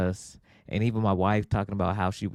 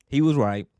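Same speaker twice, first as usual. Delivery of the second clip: ordinary talk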